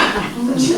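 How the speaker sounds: short, high burst of laughter; laughing at the Stetson story; amused laugh. Real, not acted. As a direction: laugh at your own punchline. Laughs at the end of his own story.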